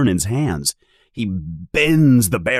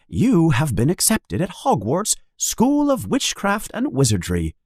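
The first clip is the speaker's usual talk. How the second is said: higher formal letter reading; letter read aloud; 8 semitones higher than usual. Acted. Harry reading his Hogwarts letter, higher formal read.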